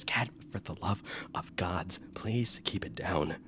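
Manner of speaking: exasperated whisper; hushed plea. Acted. Jaren shushing a noisy guard, exasperated whisper. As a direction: whisper it slowly, pleading for quiet.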